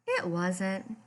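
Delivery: plain reading voice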